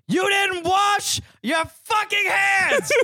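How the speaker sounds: slow, high, outraged shout; disgusted roommate screaming at his friend; retold furious yell. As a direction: yell it out in disgust, dragging each word.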